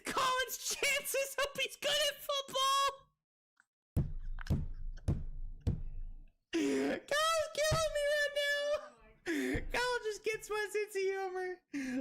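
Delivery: high, squealing through laughter; helpless laughter. Real, not acted. Streamer losing it at a friend's humor, helpless laughter. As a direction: barely get the words out laughing. Cracking up at own joke.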